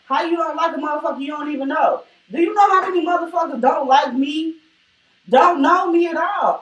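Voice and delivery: loud, heated shouting; angry shouting. Real, not acted. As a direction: yell it out, fed up. Heated rant about being disliked.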